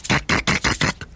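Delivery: crunch it out under the breath; crunching sounds. A narrator's reading. Carrot crunching noise. (leises knackendes Kauen)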